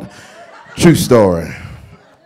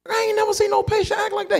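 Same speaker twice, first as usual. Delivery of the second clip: very high shocked squeak. Retold or imitated. Usher shocked at the patient's behavior. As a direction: squeal it in disbelief.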